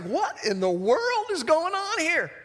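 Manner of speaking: high, swooping disbelief; baffled astonishment. Acted. Dismayed brothers react to Joseph's reveal, baffled astonishment. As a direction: swing the pitch up in bewilderment.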